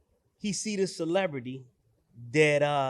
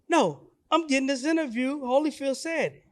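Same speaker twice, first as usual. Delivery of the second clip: high-pitched mock defiance; imitated insistence; 7 semitones higher than usual. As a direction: insist like the determined son, voice raised in pitch. Voices son defying huge guard.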